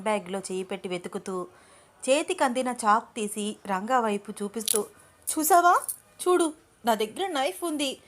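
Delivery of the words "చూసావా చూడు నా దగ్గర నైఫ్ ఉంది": shaky bravado. A narrator's reading. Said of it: "వణుకుతున్న చేతులతో".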